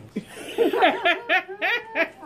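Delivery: high burst of laughter; real high laugh; big laugh. Real, not acted. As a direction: let a loud laugh burst out. Mom laughs at daddy's saying.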